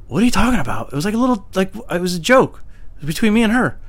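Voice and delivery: high-pitched, incredulous defence; indignant protest. Retold or imitated. Employee defending a joke to his bosses, indignant protest.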